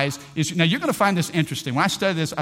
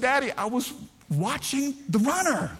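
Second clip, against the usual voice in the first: much higher, laughing innocent excuse; sheepish kid; 8 semitones higher, 3 dB quieter than usual. Retold or imitated. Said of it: "I said".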